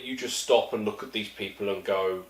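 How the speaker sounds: ordinary talk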